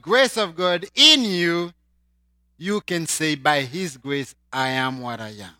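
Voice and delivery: ordinary delivery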